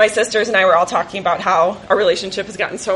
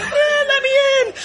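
desperate plea. Retold or imitated. Comforting crying sisters, pushed away.

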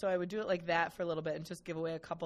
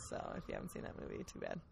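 whispered aside; 9 dB quieter, faster than usual. Real, not acted. Teasing spoiler aside.

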